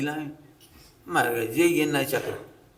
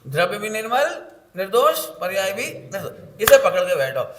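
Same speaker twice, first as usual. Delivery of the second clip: ordinary talk, nothing marked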